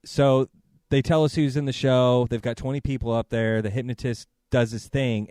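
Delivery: usual speaking voice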